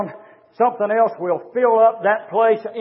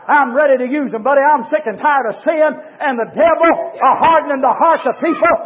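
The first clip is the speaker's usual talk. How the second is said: raised, ringing sermon climax; fiery preaching; 7 semitones higher, 5 dB louder than usual. Acted. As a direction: build the sermon to a passionate peak.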